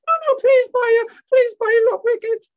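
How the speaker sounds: very high, loud squealed begging; upset monkey pleading. Acted. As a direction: beg frantically in a monkey puppet voice. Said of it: "Mickey was very upset".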